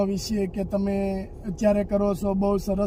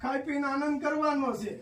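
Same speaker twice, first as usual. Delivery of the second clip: gentle consoling; 5 semitones higher, 7 dB quieter than usual. Real, not acted. Visitor comforts a crying elderly woman, softly coaxing. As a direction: soothe her like calming a grandmother.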